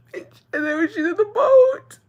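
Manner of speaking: high, squeaky tearful wail; real crying, voice pushed very high. Real, not acted. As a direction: wail it through tears, pitch way up. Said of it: "I'm crying all over again".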